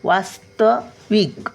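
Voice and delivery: वास्तविक slowly, light s then a full त. Shown as a demonstration. Teacher showing half and full letters, वास्तविक slowly in parts. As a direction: split वास्तविक, giving त its full vowel. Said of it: "सचा उच्चार थोडासा आणि तचा उच्चार पूर्ण".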